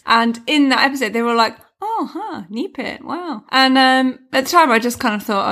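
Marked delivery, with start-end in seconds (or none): 1.8-3.4 hushed, amazed "knee pit, wow"; mock wonder. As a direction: breathe it like a discovery. Quoting Ally McBeal episode.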